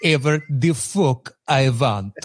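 slow, hammed-up Russian accent. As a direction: mimic a thick movie Russian accent, drawn out. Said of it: "that Russian accent".

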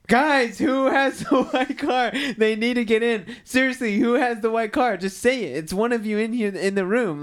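high, loud impatient calling out to the room; retold loud call. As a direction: yell it across a crowded room, getting fed up. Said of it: "he's like".

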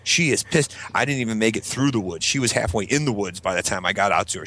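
normal talking voice

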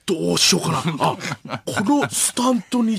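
theatrical thinking aloud; 伊集院光. Acted. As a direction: feign being stumped, then hit on an idea. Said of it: "伊集院光さんが". (大げさに悩む伊集院のものまね)

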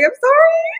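very high, squeaky mock apology; tiny high-pitched re-enacted sorry; sheepish apology. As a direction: squeak it out, embarrassed. Acting her embarrassed apology.

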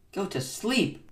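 high-pitched exclamation; shouted. A narrator's reading. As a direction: exclaim it, pitch jumping up on the words. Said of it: "shouted Toad".

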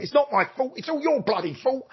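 high, exultant flip of blame; triumphant outburst. Retold or imitated. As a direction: throw the blame back, elated. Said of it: "left this lift and went".

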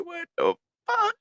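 high, squeaky what-the-hell; shocked disbelief. Retold or imitated. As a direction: shriek it in sudden disbelief. Car won't crank, disbelief.

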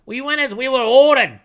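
very high, loud whining goblin; complaining goblin. A narrator's reading. Goblin chieftain protesting to the wraith, high loud whine. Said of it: "Bruck complained".

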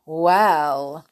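ordinary delivery

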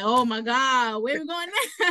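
lightly imitated exasperated child, pitch climbing; mock a little kid's whine of protest; expressive son's complaint. Mom imitates son fed up with moving somewhere else, mildly exasperated. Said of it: "he was very expressive".

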